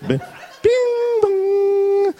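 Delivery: high, ringing "bing bong"; doorbell imitation. Preacher showing how the parrot mimics the doorbell, high chiming imitation. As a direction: imitate a two-note doorbell chime, held long. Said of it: "It can also mimic the doorbell going".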